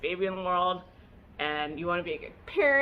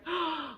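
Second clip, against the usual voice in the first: delighted gasp; 8 semitones higher, 4 dB quieter than usual. Retold or imitated. Baby laughs for first time.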